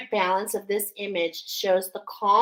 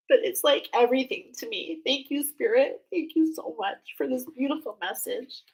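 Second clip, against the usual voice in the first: higher voice, close to tears; emotional gratitude; 4 semitones higher than usual. Real, not acted. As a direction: give thanks while choked up. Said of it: "I'm really emotional".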